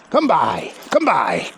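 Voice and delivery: herding command. Real, not acted. Trainer commanding the sheepdog to circle.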